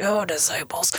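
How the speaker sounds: whispered exasperated sigh; a touch of weary exasperation. Retold or imitated. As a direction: breathe it out in fond exasperation. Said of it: "he was exasperated".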